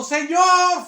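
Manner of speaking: very high, loud shout; acted desperate cry. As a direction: clamour for the Lord at full voice. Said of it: "Porque le llega clamando".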